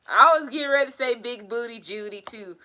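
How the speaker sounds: usual speaking voice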